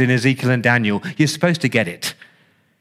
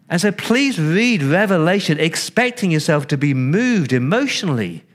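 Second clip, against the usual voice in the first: ordinary talk, nothing marked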